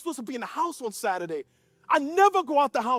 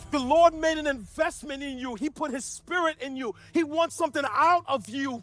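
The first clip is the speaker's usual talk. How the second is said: full-force preaching with raised pitch; impassioned preaching; 4 semitones higher, 5 dB louder than usual. Acted. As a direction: preach it in long, rising, emphatic phrases. Preaches at full force, voicing God.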